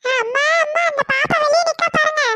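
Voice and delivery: put-on high child's call for mum; calling mother. Child alerting mother to the little one outside, calling out. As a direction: raise the pitch and call out urgently.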